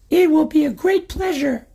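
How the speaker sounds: put-on very high, sweetly polite old man; sly old man. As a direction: chirp it with fake courtesy. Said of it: "said the old man".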